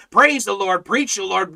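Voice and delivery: normal talking voice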